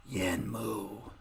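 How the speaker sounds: low, slow, raspy death rattle; grim reaper voice, dragged out low. Acted. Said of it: "he says in this raspy voice of death".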